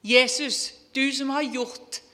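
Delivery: building from a call to a loud, desperate plea; crying out to Jesus. Retold or imitated. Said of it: "Jeg, jeg ropte til Jesus".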